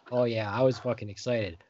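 ordinary talk, nothing marked

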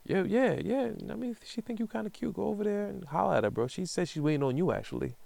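high-pitched excited hype-man voice, imitating a friend; a retold friend's eager encouragement, voiced high; hyping him up.

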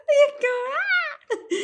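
laughing. Real, not acted. Laughing at the movie scene.

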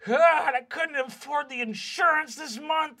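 high, loud wailing father; distraught dad. Acted. Unemployed dwarven dad wailing about his wrecked house. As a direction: wail over the lost insurance.

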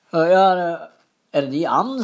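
"Å ja, det" jumps up high and loud, then settles; lightly voiced surprised stranger; man at the fjord. The man by the fjord asking about the duck, surprised. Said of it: "sier han".

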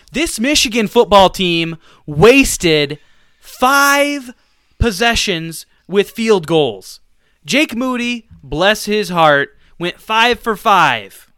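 loud, slow, rising frustration; heated rant. Real, not acted. Fan ranting about field goals, loud and heated.

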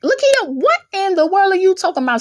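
high-pitched, incredulous outburst; baffled listener. Acted. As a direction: squeal it in mock disbelief, then rush on. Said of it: "Some of y'all like".

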